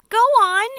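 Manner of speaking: high, loud frantic cry; the whole group crying out for James to go on. Acted. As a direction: urge him on in desperation. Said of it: "they cried frantically".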